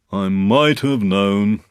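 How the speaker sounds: very slow, resigned donkey; Eeyore. Acted. Eeyore learning the gift is Pooh's, resigned. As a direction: draw it out in resignation.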